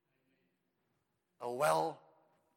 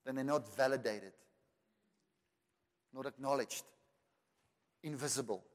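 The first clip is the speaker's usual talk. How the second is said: usual speaking voice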